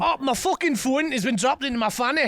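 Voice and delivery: high mock Scottish dismay; Scottish accent. Friend playing the woman on cue, high Scottish accent. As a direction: wail it lightly in Scottish. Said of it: "I'll do a very good Scottish accent".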